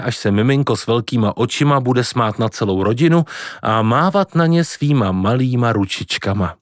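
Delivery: ordinary delivery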